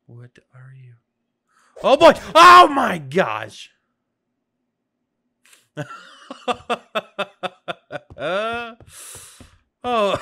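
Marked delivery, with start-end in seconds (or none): 1.8-3.7 loud, drawn-out shocked cry; shocked exclamation. Real, not acted. Surprised by a sudden attack. 5.7-9.5 long, high, disbelieving laugh; burst of laughter. Real, not acted. Laughs after the sudden hit.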